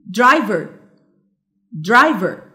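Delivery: درايفر with the first syllable درا hit harder; correct first-syllable stress. Shown as a demonstration. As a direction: punch the opening of درايفر. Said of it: "which has the correct".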